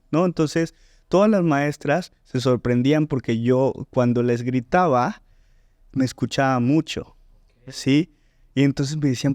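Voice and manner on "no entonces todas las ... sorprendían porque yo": ordinary talk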